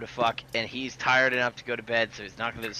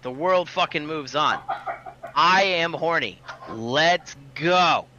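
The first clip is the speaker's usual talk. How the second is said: higher and louder, slow and insistent; mock outburst, pitched up and loud; retold exasperated shout; 5 semitones higher, 5 dB louder, slower than usual. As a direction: bark it back at her, fed up. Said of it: "I'm like".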